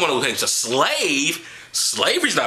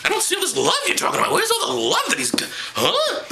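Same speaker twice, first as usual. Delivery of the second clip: mock baffled reader. Reader searching the Bible, high mock bafflement. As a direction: act lost looking through the book.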